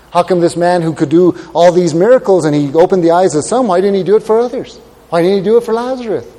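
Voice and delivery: mock incredulous challenge; doubters' protest. Voices the doubters' complaining questions.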